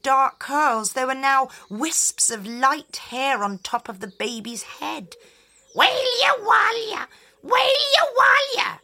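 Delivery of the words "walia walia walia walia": put-on high loud eerie baby wail; shrill-free high wail of a fairy child; wailing changeling. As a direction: cry out high like a strange hungry baby. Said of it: "The baby cried and cried".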